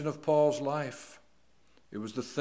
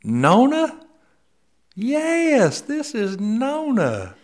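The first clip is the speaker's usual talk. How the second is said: delighted recognition; 12 semitones higher, 13 dB louder, much slower than usual. Retold or imitated. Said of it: "I said".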